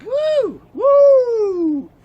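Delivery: high, loud whoops of joy; excited woo shouts; whooping cheer. Real, not acted. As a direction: cheer it out wildly. Celebratory whooping after a joke.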